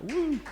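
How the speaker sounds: short, high-pitched whoop; high cheering woo. Real, not acted. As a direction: let out a high woo for the crowd. Host cheers the turnout.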